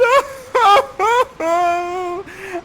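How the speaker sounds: high mock wail; mock sobbing for his dead father; hammed-up sobbing. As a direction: fake a big theatrical cry. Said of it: "Ferdinand sobbing and crying".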